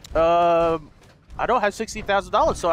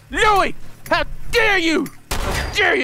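high-pitched, theatrical outrage; mock indignation; 8 semitones higher than usual. Player scolding the dog Louis, mock indignation. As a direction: scold as if deeply affronted.

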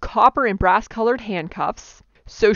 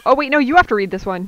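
usual speaking voice

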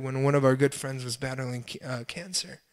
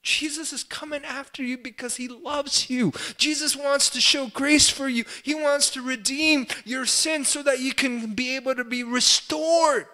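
high, impassioned pleading cry; anguished plea; 11 semitones higher, 4 dB louder than usual. Acted. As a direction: plead in desperate anguish, voice pitched way up. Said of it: "That anguish, that plea".

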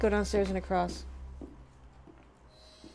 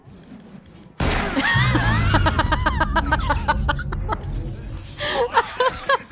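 loud burst of laughing; loud laughter; 7 dB louder than usual. Real, not acted. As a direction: crack up loudly. Big laugh at the game.

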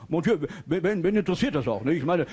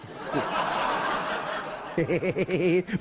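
bleating laugh. Retold or imitated. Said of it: "Mainzelmännchen".

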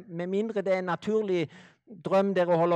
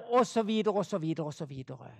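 trailing off, lower and much softer each time; mock meditation fade; 5 semitones lower, 3 dB quieter, faster than usual. Preacher mocking guided meditation, fading out. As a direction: sink the voice away like a hypnotist.

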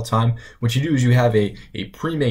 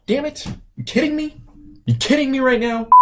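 exasperated; 14 semitones higher than usual. Acted. Exasperated at glitch.

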